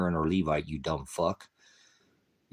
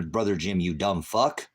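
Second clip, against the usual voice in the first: normal talking voice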